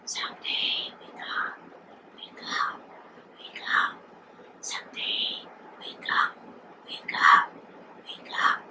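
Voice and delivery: whispered chant, repeating and slowing; endless mumbling. A narrator's reading. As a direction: whisper it as the sea's endless refrain. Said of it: "turning their ears to the endless mumbling".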